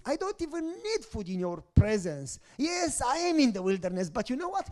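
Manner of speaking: high, rising impassioned worship voice; fervent prayer. Acted. Preacher voicing David's psalm as fervent, high-pitched prayer. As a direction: pour out David's love to God, building.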